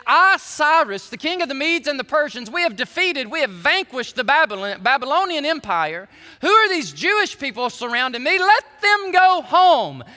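loud, high royal proclamation; regal declaration. Retold or imitated. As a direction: announce it like a pagan king. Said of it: "make a regal declaration".

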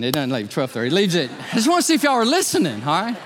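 normal talking voice

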